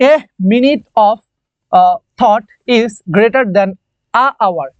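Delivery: very slow, every word apart; slow example reading. Shown as a demonstration. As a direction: read the example out for the class. Teacher reading example sentence aloud.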